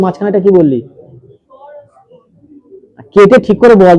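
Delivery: ordinary delivery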